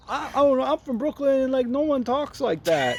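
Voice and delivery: high, mocking New York accent of a Brooklyn woman; New York accent, lampooned. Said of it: "kinda cringy New York accent".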